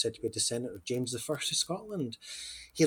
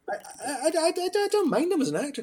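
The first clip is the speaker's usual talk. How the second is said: mock protest; 4 dB louder than usual. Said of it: "put-on voice protesting about Hugh Grant".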